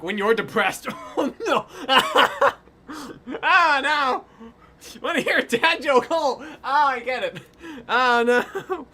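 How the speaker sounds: loud, high laughter breaking through; cracking up at a bad joke; laughing out loud. Real, not acted.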